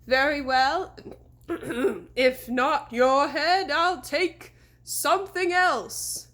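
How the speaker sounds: slow, loud looming phantom voice; ghostly horseman. A narrator's reading. Fake horseman offering a bargain, slow and ghostly. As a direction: bargain like a patient spirit.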